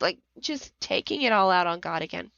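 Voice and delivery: usual speaking voice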